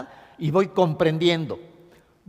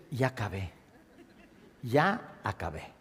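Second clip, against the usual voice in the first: relieved sigh; 7 semitones lower, 7 dB quieter than usual. Shown as a demonstration. Patient done crying, soft relieved sigh of peace. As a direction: let it go, spent and calm. Relief after the last retch.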